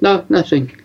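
high, coy brush-off; coy denial. Retold or imitated. Wife dodging her husband's question, coy high denial. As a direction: wave the question away, hiding something. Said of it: "you know what that "no, nothing" looks like".

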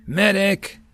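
high shout for a medic; calling out. A narrator's reading. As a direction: call the medic across the field. Wounded Marines calling for help.

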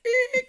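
high squeaky stair creak; squeaked stair noise; creak imitation. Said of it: "it creaks".

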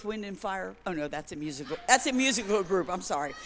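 ordinary delivery

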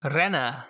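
Renner with a trilled rolled R. Acted. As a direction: roll the R in Renner hard. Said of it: "I don't know why I rolled my R's".

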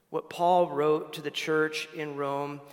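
normal talking voice

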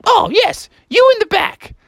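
high, impatient teacher goose; Mother Goose. Acted. Teacher moves on to the next pupil, high impatient goose. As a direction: call on the class in falsetto. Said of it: "And she's like".

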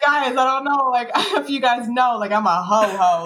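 laughing retold line.

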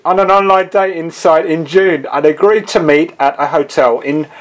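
ordinary talk, nothing marked

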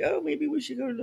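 high, quick mock worrier; nervous fretting, imitated. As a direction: imitate a fussing partner, fast and high.

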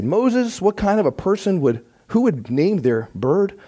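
high mock disbelief from a burglar; incredulous thief. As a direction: scoff at the silly bird name. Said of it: "And the thief laughed".

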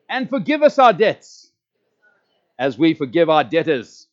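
loud, rising, fervent recitation; fervent prayer. Shown as a demonstration. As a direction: proclaim each phrase with full force. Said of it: "you've gotta say it with absolute fervency".

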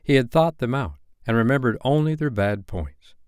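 ordinary delivery, nothing marked

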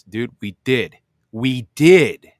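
real frustration bursting out on the mic; worked up. Real, not acted. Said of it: "Sorry for getting worked up".

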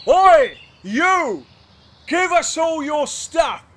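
high, loud highwayman bark; shouted demand. Acted. Highwayman's first try, a loud shouted demand. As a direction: bellow it at the rider. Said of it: "follow my lead".

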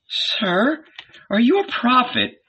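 high, wondering woman's voice; amazed woman. Acted. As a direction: ask in awe.

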